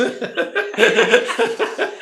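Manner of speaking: hearty laugh; big high laugh. Real, not acted. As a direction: burst of real laughter, high. Laughs at his own joke about bills.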